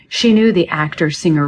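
ordinary delivery

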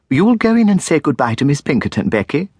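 a hint of a flustered schoolmistress calling out; Miss Jemima. A narrator's reading. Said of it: "said Miss Jemima".

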